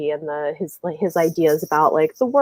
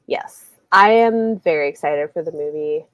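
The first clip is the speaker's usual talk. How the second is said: ordinary talk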